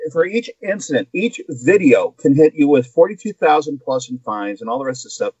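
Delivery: ordinary talk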